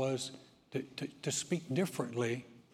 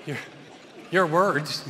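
laughing; 7 dB louder, slower than usual. Real, not acted. Talking through laughter.

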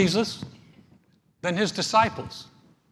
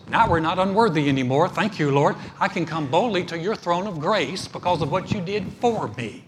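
rising, louder preacher's praise; louder, building sermon praise; preaching at full force; 6 dB louder than usual. Acted. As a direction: lift it up as a declaration of faith.

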